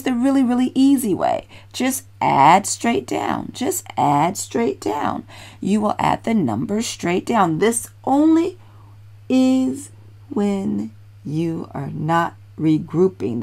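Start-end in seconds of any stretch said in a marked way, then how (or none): 1.7-5.3 deliberately slow, spaced-out instruction; slow and clear. Real, not acted. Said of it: "Let me say that slowly". 7.6-13.4 very slow, word-by-word caution; slow and deliberate. Real, not acted. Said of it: "Let me say that slowly".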